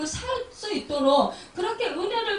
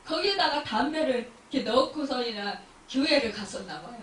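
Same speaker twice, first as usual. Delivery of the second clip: normal talking voice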